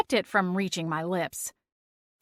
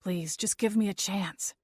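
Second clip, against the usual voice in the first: softer, cracking plea; voice breaking; 5 dB quieter than usual. Acted. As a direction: beg through a catching throat. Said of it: "Clint's voice broke".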